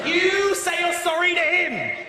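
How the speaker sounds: slow, high telling-off; scolding referee. Retold or imitated. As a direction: talk down like to a child. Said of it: "like they're children".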